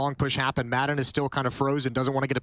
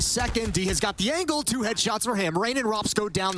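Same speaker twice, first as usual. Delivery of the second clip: excited; 7 semitones higher than usual. Real, not acted. Caster hypes double headshot.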